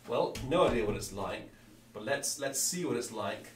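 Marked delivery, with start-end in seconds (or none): none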